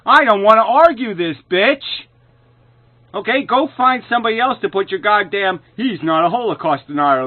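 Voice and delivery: loud, high furious rant; real furious tirade at an unseen opponent; angry shouting. Real, not acted. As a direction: yell it at them as hard as you can. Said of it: "saying it as aggressively and as loudly as I possibly can".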